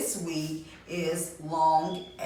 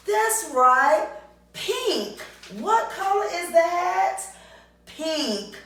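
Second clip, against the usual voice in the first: much higher and louder joyful praise; excited praise; 9 semitones higher, 6 dB louder, slower than usual. Real, not acted. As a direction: cheer the class on.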